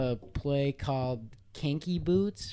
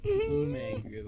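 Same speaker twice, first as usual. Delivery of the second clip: high squeaky echo; slower than usual. Pretend. Echoes name in high voice.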